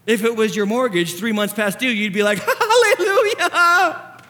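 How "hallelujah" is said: long, high, loud mock rejoicing; hammed-up jubilation. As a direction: belt it out in exaggerated relief. Acted joy at paid-off mortgage.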